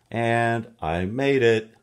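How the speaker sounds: loud, proud arrival; triumphant. Acted. Reading to kids, a proud triumphant finish. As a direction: announce it like a hero.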